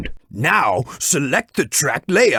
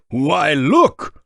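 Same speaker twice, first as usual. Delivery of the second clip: ordinary delivery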